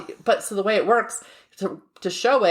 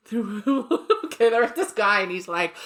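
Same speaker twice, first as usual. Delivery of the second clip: normal talking voice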